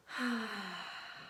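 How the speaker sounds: soft long exhale; deep breath out. Shown as a demonstration. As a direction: breathe out slowly for the kids. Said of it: "take a deep, nice breath".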